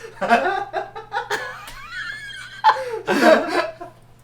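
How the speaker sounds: high loud cackling laugh; shrieking laugh. Real, not acted. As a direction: burst into screaming laughter. Big laugh.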